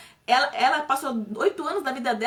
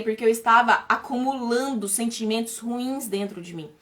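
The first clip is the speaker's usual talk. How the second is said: normal talking voice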